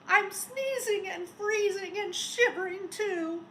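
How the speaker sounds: high, loud shivery complaint; shivering snowman. A narrator's reading. Snowman complaining he is freezing, high and loud. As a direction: grumble through the chill.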